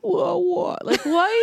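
high whiny whoa; Adam Sandler impression. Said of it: "that sounded more like, uh, Penny Marshall".

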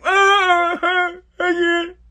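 very high, wailing imitation; mock whining. Mom looking for sympathy from son, mock whining. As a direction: whine and wail it, hammed-up. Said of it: "Whining like".

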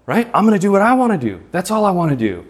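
exaggerated whiny teenager, pushing it up; mocking selfish voice. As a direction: ham up the spoiled me-first attitude. Voicing a selfish young person.

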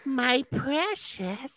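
high, very slow hissing creature; creepy creature voice. Pretend. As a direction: stretch out each word like a creepy ring-obsessed creature. Same creature quote again.

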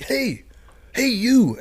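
high-pitched mock call across a line. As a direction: imitate a stranger hailing someone from afar. Imitating an official calling across line.

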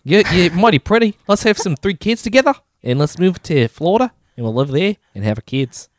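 mock-Aussie suitor making a proposal; playful, lilting New Zealand accent; Korg. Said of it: "harnessing my inner Korg".

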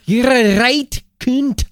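mock British insult. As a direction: sneer it like a cockney bloke. Repeats insult, bigger.

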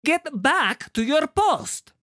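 very high, loud, slow order; shrill cry. Acted. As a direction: shout the command in a shrill voice. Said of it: "a shrill voice cried".

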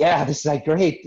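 proud delight; excited. Retold or imitated. Proud of gold heart.

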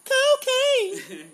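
high, loud shouted punchline; joking shout. Pretend. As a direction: yell it like a crazed party guest.

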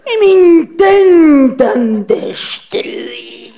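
theatrical wailing, slow and teary; hammed-up sobbing. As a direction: overact a tragic breakdown. Melodramatic fake breakdown. (llanto melodramático exagerado)

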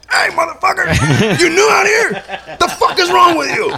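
high, drawn-out confrontational yell; slow, hammed-up furious shouting; re-enacted angry shout. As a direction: holler across the water at another boat. Retells confronting the other boat.

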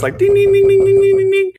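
high, loud sung ding ding; doorbell imitation. Imitating mom ringing the doorbell over and over. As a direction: ring it out like a doorbell. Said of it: "rings the doorbell like this".

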